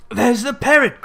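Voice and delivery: high, loud delighted cry; sudden joyful outburst; excited cry. A narrator's reading. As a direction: call out in childlike delight. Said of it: "cried Scrooge".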